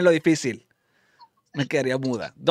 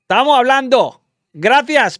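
loud, indignant dismissal; annoyed cut-off; 5 semitones higher, 7 dB louder than usual. Pretend. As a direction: shut the caller up in comic anger. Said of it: "Me hace enojar aquí".

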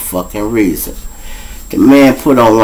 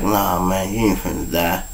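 much softer, lower comforting lie; soft reassurance; 3 semitones lower, 10 dB quieter than usual. Retold or imitated. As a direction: hush him gently. Lying to a dying friend.

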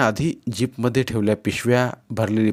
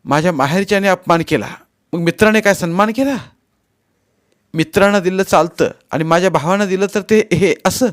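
high, loud indignant argument; irritated retort; 9 semitones higher, 7 dB louder than usual. A narrator's reading. Wife defending her family to husband, irritated. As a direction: challenge him, voice climbing. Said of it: "Sulu चिडून म्हणाली".

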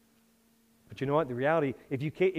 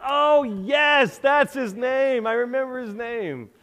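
sudden recall; 8 semitones higher, 5 dB louder, much slower than usual. Retold or imitated. Retold late-night realization.

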